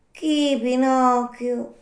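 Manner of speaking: high, drawn-out little snail voice; slow snail. Acted. Snail calling down to a night caller, slow snail voice. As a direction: stretch each word like an unhurried snail.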